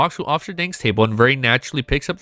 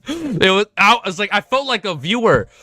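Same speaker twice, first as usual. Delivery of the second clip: laughing; 7 semitones higher than usual. Real, not acted. Still breathless after laughing fit.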